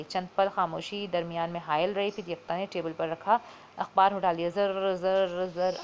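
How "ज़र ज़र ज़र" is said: imitated rasping zr of a spinning globe; grinding metal noise. A narrator's reading. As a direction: mimic the grating whirr, zr zr zr. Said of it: "आवाज़ पर".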